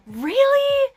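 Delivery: very high, loud squeal of a question; high, loud delighted squeal; thrilled disbelief. Retold or imitated. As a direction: shriek it in delighted surprise. Thrilled disbelief at good news.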